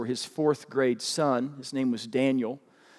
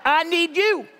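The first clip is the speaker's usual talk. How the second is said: said back; 6 dB louder, slower than usual. Shown as a demonstration. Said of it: "And then you say it back".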